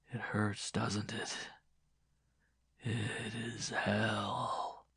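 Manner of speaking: soft, very slow whispery taunting; sadistic murmur. Acted. Man keeps pouring as he talks, softly cruel. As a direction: relish it in a drawn-out hush.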